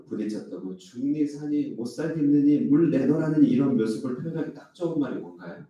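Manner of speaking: ordinary delivery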